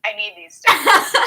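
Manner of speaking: loud, drawn-out plea for stickers; pleading. Real, not acted. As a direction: demand the stickers like a fan. Begging for the stickers.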